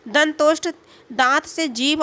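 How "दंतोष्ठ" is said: usual speaking voice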